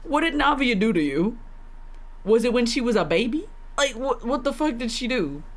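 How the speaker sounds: ordinary delivery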